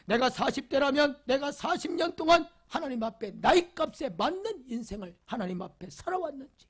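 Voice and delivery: usual speaking voice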